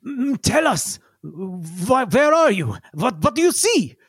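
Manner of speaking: high, urgent, far-off radio voice; eager scientist voice. Acted. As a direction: press for answers. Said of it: "Yigsel says".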